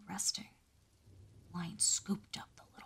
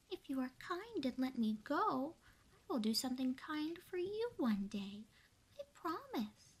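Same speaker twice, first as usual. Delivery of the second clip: earnest mouse; 7 semitones higher than usual. Acted. Mouse bargains with the lion.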